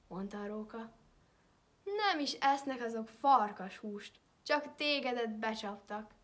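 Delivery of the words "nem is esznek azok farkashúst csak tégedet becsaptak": mocking fox. A narrator's reading. Fox mocks the wolf.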